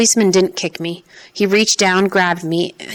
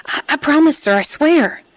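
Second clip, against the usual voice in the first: put-on high, stammering little boy pleading; terrified child; 9 semitones higher than usual. Boy pleading with the interrogating officers, high and stammering. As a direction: play small and scared, stumbling over the I. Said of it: "I had to make myself a child, just a terrified, foolish child".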